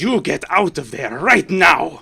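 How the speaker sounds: stern command. Acted. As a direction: order them out like a furious matron. Lady ordering party out.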